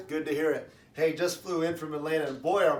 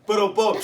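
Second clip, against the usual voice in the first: loud mimicked drum sting; vocal rimshot; 2 semitones higher, 7 dB louder than usual. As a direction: hit it like a drummer capping a joke. Voiced drum rimshot after the punchline.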